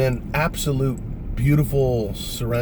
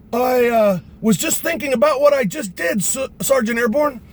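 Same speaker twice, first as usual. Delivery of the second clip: high, raised voice with a stumble; shouted trainee reply; 12 semitones higher, 3 dB louder than usual. Retold or imitated. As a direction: call the answer up to the sergeant. Trainee shouts his answer back.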